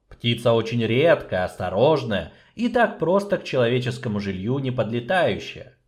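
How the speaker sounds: usual speaking voice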